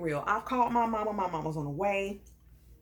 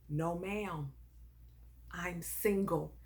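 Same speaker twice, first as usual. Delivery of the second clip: low, soft, measured retold reply; quiet denial; 4 semitones lower, 8 dB quieter, much slower than usual. Man denying to girlfriend's mother that he's taken, low and quiet. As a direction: answer quietly and slowly, pausing between phrases. Retelling his straight-faced denial to mom.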